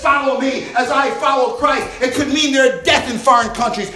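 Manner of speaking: loud, driving preacher's cadence; preaching at full force. Acted. Pastor stirring the congregation about faith, full-force preaching. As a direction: preach it and build to the crowd.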